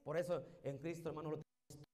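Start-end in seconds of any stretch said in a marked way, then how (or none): none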